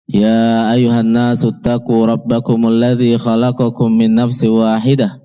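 normal talking voice

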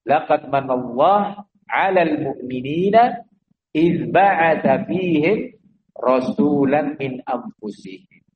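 slow, measured Arabic recitation of a verse; verse recited mid-sermon; Quranic recitation. Acted. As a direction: recite the verse with reverence, unhurried.

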